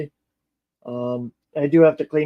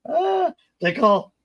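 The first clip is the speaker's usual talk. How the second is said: laughing through the words, higher; 10 semitones higher, much slower than usual. Real, not acted. Laughing while telling viewers about the nickname. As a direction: giggle into the line.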